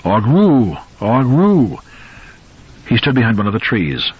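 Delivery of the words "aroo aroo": gruff howling dog. Acted. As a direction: howl it out loud like a dog. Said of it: "It began to howl, its gruff voice".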